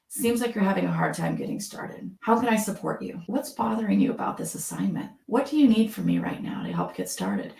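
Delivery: soft, non-threatening late-night radio host. Shown as a demonstration. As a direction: lean in gently with empathy, softly. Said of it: "the late night F M D J voice".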